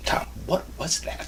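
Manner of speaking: whispered mock director's aside; hushed, incredulous director voice; imagined director. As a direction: breathe it like a baffled director. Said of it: "imagine the director going".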